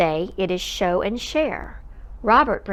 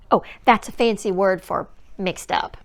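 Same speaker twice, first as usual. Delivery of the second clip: plain reading voice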